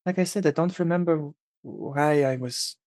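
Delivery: put-on quiet French accent; a soft French lilt. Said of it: "she's speaking in a French accent".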